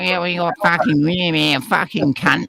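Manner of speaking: hint of an Australian yobbo impression; mock Aussie accent. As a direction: drawl it like a mocking Australian. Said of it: "You actually do it perfectly".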